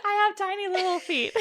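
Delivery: laughing through the words about her tiny feet; giggly. Real, not acted. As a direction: giggle it out, a bit embarrassed.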